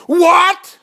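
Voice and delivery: high, loud outraged outburst; angry shout. Acted. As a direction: explode in indignation on one word. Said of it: "they start getting angry".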